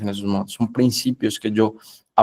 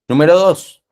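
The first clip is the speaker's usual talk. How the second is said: loud announcement; 4 semitones higher, 8 dB louder than usual. Real, not acted. Preacher announcing the next point.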